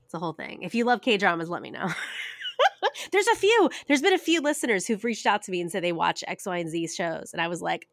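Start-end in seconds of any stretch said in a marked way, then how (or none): none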